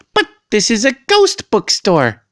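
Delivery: small, high squeaky mouse voice. A narrator's reading. Mouse talks back to the cat, high squeaky little mouse voice. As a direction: squeak it like a tiny mouse protesting. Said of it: "said a mouse".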